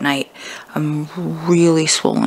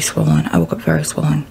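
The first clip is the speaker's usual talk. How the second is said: whispering. Real, not acted. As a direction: whisper it softly. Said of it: "I'm being quiet 'cause the kids are still sleeping".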